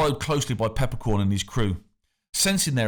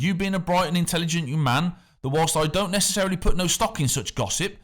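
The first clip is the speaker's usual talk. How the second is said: affable official with a hint of menace, higher; smooth and friendly on top, threat underneath; the factor; 7 semitones higher than usual. A narrator's reading. The factor coaxes the boy about treasure, affable with a hint of menace.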